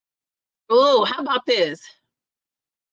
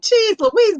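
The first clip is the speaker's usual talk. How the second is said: surprised exclaim; 9 semitones higher, 5 dB louder than usual. Real, not acted. Reacting to scheduled-text comment.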